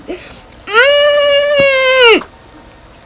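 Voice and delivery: long, loud, high held cry; high acted squeal. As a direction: squeal in exaggerated reaction. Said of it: "She's like".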